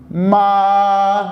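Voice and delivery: ما with the mouth wide open on a long a. Shown as a demonstration. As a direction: stretch the vowel in ما, mouth open. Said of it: "آ کے اوپر منہ زیادہ کھلے گا".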